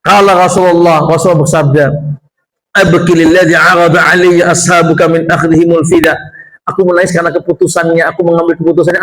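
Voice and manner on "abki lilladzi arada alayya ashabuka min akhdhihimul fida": solemn Arabic quote. Acted. Quoting the Prophet in Arabic.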